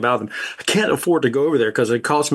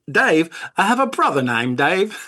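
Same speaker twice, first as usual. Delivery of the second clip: higher-pitched imitation of an Aussie; Australian accent; 4 semitones higher than usual. Imitating Chris being introduced to Dave, higher Australian accent. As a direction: mimic an Australian acquaintance for laughs.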